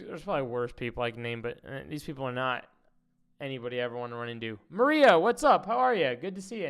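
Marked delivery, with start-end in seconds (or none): 4.7-6.7 much higher, louder cheerful hello; excited greeting. Real, not acted. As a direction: greet Maria with a burst of excitement. Greeting chat viewer loudly.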